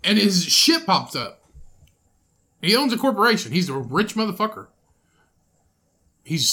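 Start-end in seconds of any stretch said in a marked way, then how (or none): 0.0-1.4 loud exasperated shouting; angry yelling. Real, not acted. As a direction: yell it in frustration. Heated argument about Bloomberg.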